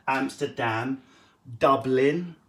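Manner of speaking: ordinary talk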